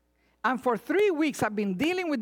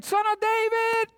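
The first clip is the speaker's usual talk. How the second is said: crying out from afar; 8 semitones higher than usual. Acted. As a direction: call out desperately from a distance. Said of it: "the woman from the distance is saying".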